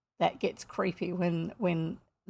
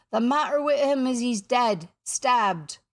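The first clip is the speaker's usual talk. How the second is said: raised, loud, haunted report; hollow voice; 4 semitones higher, 6 dB louder than usual. A narrator's reading. Italian pirate reports a stabbing to his captain, hollow voice. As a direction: deliver the bad news hollowly. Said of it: "in a hollow voice".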